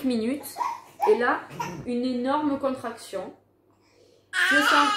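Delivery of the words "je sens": pained cry. Retold or imitated. Sharp pain of a huge contraction.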